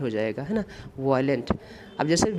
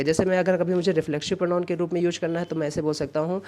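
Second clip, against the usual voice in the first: normal talking voice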